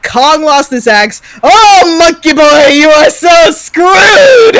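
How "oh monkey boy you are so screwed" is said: loud, high, mocking monster taunt; gloating taunt. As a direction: jeer at the beaten ape, drawing it out. Taunting Kong during the fight.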